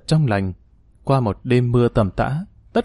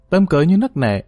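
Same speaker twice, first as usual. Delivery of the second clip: plain reading voice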